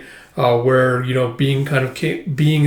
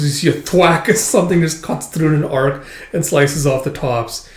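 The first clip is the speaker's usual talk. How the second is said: ordinary talk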